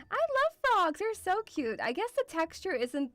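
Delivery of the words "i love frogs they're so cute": high, cooing delight; adoring gush. Real, not acted. Vlogger telling viewers about loved frogs, gushing. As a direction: gush over them in a high voice.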